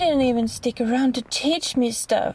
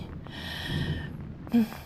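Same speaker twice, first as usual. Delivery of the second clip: breathy hungry sigh; long soft sigh; 8 dB quieter than usual. Acted. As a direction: let the hunger out in one breath. Hungry longing.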